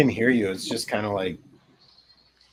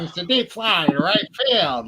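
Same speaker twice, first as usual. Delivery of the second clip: vintage radio play-by-play voice; old baseball announcer; 6 semitones higher than usual. Retold or imitated. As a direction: call it like a forties ballgame broadcast. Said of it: "like, forties baseball".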